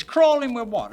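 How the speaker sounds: high, loud incredulous retort; astonished. Retold or imitated. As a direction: shoot the question back in disbelief.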